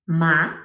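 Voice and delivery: "ma" rising like a question; questioning upward lift on "ma"; second tone. Shown as a demonstration. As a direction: let the pitch climb a hill on "ma". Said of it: "rising like a questioning intonation".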